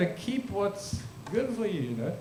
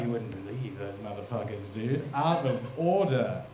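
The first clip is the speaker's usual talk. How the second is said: mock grumble; 7 semitones lower, 4 dB quieter than usual. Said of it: "I'm like".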